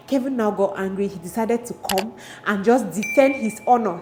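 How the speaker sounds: usual speaking voice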